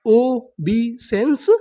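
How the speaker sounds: very slow, drawn-out reading; ओबीसेंस drawn out slowly, syllable by syllable; slow syllable demo. Shown as a demonstration. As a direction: stretch each syllable of ओबीसेंस. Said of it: "तो हमारी पूरी meaning".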